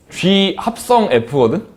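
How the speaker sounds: "G 합성 F" drawn out slowly; slow Korean reading. Shown as a demonstration. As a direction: spell out "G 합성 F" for the class. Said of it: "한국말로는". ("G 합성 F"를 아주 천천히)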